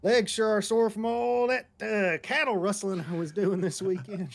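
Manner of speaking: loud mock Southern cowboy; cowboy accent. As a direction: ham up a Texan cowboy greeting. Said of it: "I would do the".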